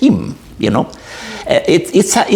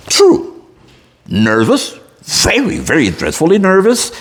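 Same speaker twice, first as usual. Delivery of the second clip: theatrical reading of a gothic tale, higher and intense; dramatic story reading; 4 semitones higher than usual. Acted. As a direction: read it like a storyteller gripping the audience.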